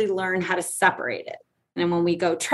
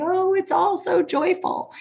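sing-song fake cheer, slow; sarcastic, sugary "joyful"; mock cheerful; 9 semitones higher than usual. Mocking the expected line, fake joyful. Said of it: "I'm supposed to say".

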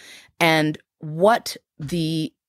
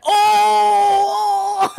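high, loud shocked "Oh!"; a bus full of people gasping oh; crowd's shocked oh; 4 dB louder than usual. Retold or imitated. Bus passengers reacting to the comeback, loud shocked oh. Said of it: "the people on the bus were like".

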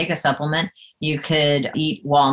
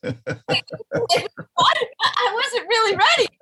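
high-pitched, laughing disbelief; retold shock; much slower than usual. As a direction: squeal it in mock alarm, half laughing. Retells shock at dog's arrival.

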